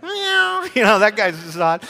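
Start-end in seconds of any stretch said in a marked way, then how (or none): 0.0-0.7 high-pitched cat meow. Acted. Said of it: "I could go like".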